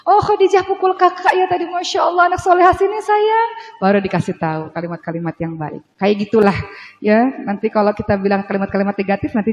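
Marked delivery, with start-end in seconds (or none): 0.1-3.5 gentle correction. Shown as a demonstration. Mother calling her daughter after hitting, high tender coaxing. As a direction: call the child over tenderly. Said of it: "Seperti itu".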